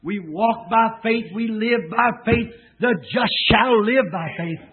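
slow, weighty preaching cadence; preacher chanting the faith refrain; slow emphatic declaration. Real, not acted. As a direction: lay each phrase down.

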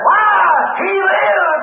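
high, jubilant pulpit cry; ringing cry of faith; exultant preaching. Real, not acted. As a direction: proclaim it with joy.

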